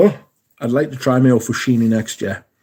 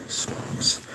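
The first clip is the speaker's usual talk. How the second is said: whisper; 11 dB quieter than usual. Real, not acted. Whispering a word to himself.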